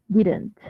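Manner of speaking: didn't with the middle d as a quick tap, "diran't"; pronunciación informal de didn't. Shown as a demonstration. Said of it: "solamente así se pronuncia". (didn't pronunciado como "diran't", con d suave)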